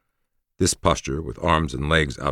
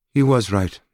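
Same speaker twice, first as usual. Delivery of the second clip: higher, louder woman conceding; reluctant woman's voice; 8 semitones higher, 5 dB louder than usual. Acted. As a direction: admit it, eyes averted. Narrator voices Forrest.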